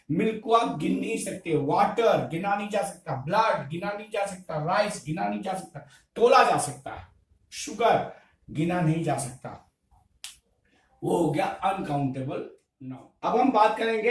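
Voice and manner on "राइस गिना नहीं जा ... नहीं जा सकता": ordinary talk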